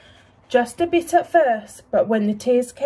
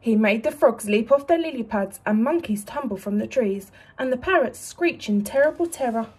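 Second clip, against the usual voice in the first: plain reading voice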